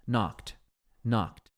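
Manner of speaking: Knocked said "nókt", ending in a crisp t; Knocked with a clear final t sound; pronunciation demo. Shown as a demonstration. Teacher modelling the past tense for students, Knocked ending in t. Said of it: "O passado pronuncia assim, ó".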